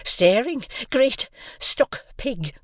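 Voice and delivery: slow, breathy whispered insult; whispered breathless scorn. A narrator's reading. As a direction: spit the insult out with no breath left. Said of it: "out of breath".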